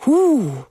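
hohes, lauteres ergriffenes Hu. A narrator's reading. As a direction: ein bewegter Ausruf. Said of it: "ordentlich ergriffen". (ergriffener Ausruf, höher und lauter)

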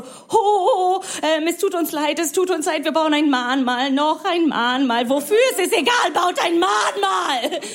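hammed-up penitent whine; mock guilty German. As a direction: beg forgiveness in a fake sob. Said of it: "ihr seid so".

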